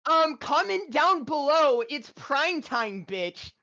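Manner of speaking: very high, loud hype YouTuber voice. Pretend. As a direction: shout it like an overexcited YouTuber. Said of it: "YouTuber voice".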